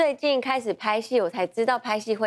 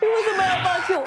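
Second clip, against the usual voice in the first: high, tearful wail; crying out; 7 semitones higher than usual. Retold or imitated. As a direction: cry it out as if abandoned. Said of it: "我真的大哭哎". (哭喊着质问)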